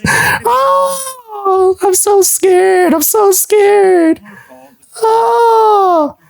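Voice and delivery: very high, slow hammed-up fright; mock terror. Taunting a rival who threatens him, high slow mock fright. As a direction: squeal it like fake terror.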